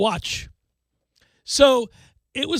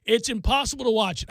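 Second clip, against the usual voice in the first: usual speaking voice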